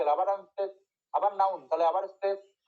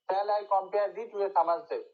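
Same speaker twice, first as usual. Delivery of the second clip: slow recitation; much slower than usual. Shown as a demonstration. Reads next poem opening line.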